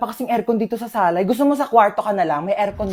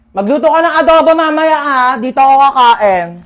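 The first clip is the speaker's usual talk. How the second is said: loud, raised-pitch demand; brazen calling out; 3 semitones higher, 7 dB louder than usual. Acted. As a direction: holler a dinner order to your aunt. Guest calls out brazen meal order. (malakas na pasigaw na utos)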